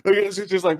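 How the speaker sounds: ordinary talk, nothing marked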